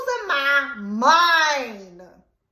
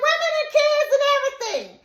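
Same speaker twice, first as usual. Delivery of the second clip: higher, fast, through a laugh; laughing exaggeration; 13 semitones higher, faster than usual. Woman joking about her threat, high fast and laughing. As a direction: toss it off laughing. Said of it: "I say".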